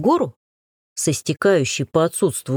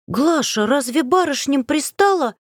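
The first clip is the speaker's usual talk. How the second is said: scandalized; 9 semitones higher than usual. Acted. Shocked noble girl.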